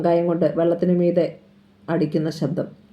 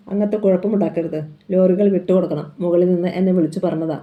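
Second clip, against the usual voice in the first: ordinary delivery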